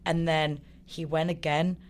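usual speaking voice

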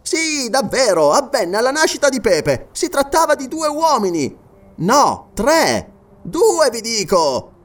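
high, emphatic character voice; insistent sergeant. Acted. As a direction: correct yourself firmly, pitched high. Sergeant's dialogue.

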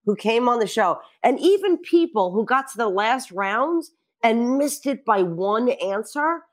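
ordinary talk, nothing marked

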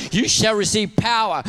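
rising shout of a preacher; preaching shout. Real, not acted. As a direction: fire up the congregation with power. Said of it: "Shout it at me".

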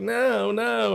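high, whiny protest; whiny girl voice. Retold or imitated. Cop imitating the girl resisting handcuffs, high whine. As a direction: play the squirming drunk girl.

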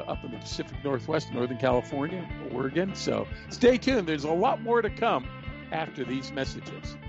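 upbeat sign-off before the break; radio announcer. Acted. Host going to break.

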